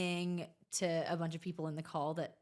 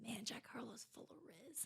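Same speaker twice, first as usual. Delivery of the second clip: whispered quote; 4 semitones higher, 19 dB quieter than usual. Retold or imitated. Quoting comments, whispered.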